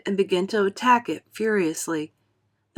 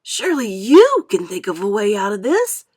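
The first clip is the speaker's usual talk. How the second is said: louder urgent plea; appealing; 7 dB louder than usual. A narrator's reading. Ladybird appealing to James for help. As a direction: beg for a way out. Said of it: "appealing to James".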